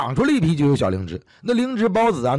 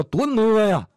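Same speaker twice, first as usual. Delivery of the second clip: higher-pitched Heilongjiang dialect saying; Northeastern dialect; 8 semitones higher, slower than usual. Shown as a demonstration. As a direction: say it in homegrown Northeastern Chinese. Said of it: "这放到东北话来讲啊".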